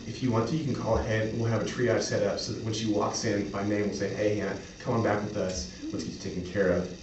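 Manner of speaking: ordinary delivery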